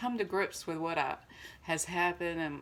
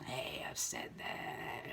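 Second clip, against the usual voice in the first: whisper; 5 semitones lower, 8 dB quieter, much slower than usual. Retold or imitated. Mocking her younger self aside.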